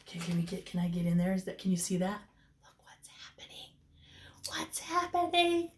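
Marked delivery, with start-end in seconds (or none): 2.6-5.7 excited whisper. Real, not acted. Teacher hushes kids, points.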